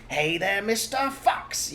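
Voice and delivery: high, slow drawn-out taunting call; slow loud high mocking call; yelled taunt. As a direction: taunt the fox down the hole. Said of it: "yelled Bunce".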